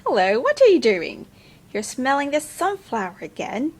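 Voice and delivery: ordinary talk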